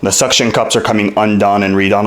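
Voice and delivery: normal talking voice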